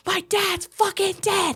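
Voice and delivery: high, drawn-out mock scream; hammed-up hysterical outburst; losing it. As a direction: screech it like a retold meltdown. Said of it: "I lost my fucking mind".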